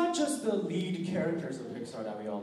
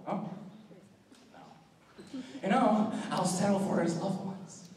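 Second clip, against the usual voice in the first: menacing villain; 5 semitones higher than usual. Acted. Cackling villain telling a captive about his scars. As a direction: taunt a victim, giggling madly, then drop to a whisper. Unnamed villain impression, scarred-face monologue.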